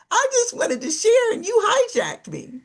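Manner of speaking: high-pitched, playful protest; hammed-up hurt caller; mock wounded complaint. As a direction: whine about being cut off. Acts out hijacked caller complaining.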